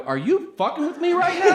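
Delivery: high, loud incredulous outburst; hammed-up incredulity, pitch shooting up; acted disbelief. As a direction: blurt it out high in exasperated disbelief. Acted disbelief at fellow passenger.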